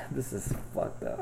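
soft unvoiced embarrassed mutter; embarrassed sigh. Real, not acted. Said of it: "this is embarrassing".

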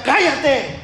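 high, slow, hushing shout; mock angry crowd telling a blind man to hush; crowd yelling to be quiet. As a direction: snap at him to shut up, drawn out. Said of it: "la gente le gritaba y le decía".

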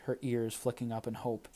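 ordinary delivery, nothing marked

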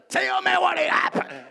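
high, strained, drawn-out plea; mock-desperate, high-pitched begging; impatient eager demand. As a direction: squeeze it out, desperate to hear the story. Impatient listener demanding the story.

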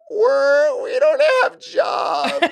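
high, slow wailing sob, half laughing; hammed-up sobbing shout; mock bawling. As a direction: imitate a manager crying down the phone. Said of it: "bawling her eyes out".

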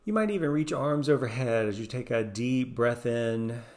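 soothing guided meditation voice. Acted. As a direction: ease the listener through each breath.

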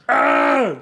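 short loud mock yell; mock grito cry. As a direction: bark a little warrior grunt. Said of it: "he'll be like".

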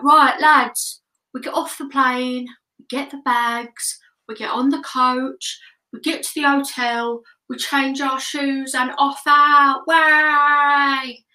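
rowdy lads' ringleader rallying the troops, loud. Retold or imitated. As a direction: hype up the lads before a holiday, loud bursts. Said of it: "and he was like".

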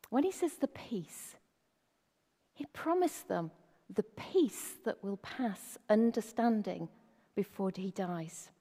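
ordinary talk

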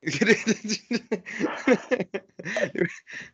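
long, high-pitched laughing fit; helpless laughter. Real, not acted. Host laughing with nephew about stuttering, helpless laughter. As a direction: burst into drawn-out high laughter.

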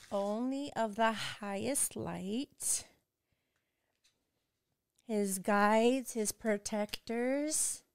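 slow, soft spiritual calling to the ancestors; solemn invocation. Acted. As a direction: call the spirits in gently and slowly, like a prayer.

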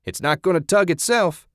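put-on loud, high drunken heckle; heckling shout. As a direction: jeer at the stage. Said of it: "the man with the exposed private shouted".

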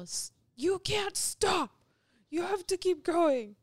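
high, loud mock shout; imitated yelling. Woman imitates her ex-husband while kayaking, yelling. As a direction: yell it like an overbearing kayak partner. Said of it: "he would yell".

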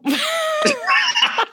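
high, shrieking laugh; big burst of laughter. Real, not acted. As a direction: burst out in a long, high cackle.

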